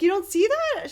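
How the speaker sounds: high, loud incredulous question; mimicking the gallery visitor's disbelief; incredulous woman. Visitor insisting on three arms.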